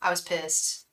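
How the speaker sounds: ordinary talk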